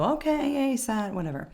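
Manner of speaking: put-on bored kids voice, rising high then sagging quiet; mock the class answering with a sigh of boredom. Teacher imitating bored students shrugging off the exercise, mock apathetic. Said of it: "And they go".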